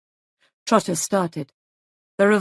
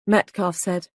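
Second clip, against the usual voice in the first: usual speaking voice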